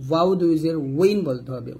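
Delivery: ordinary talk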